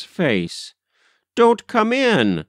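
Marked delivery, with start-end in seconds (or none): 1.3-2.4 high, frantic repeated warning; wild. A narrator's reading. Governess shutting mother out at the gate, wild. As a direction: insist wildly with the pitch jumping up. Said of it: "said Miss Simpshell again".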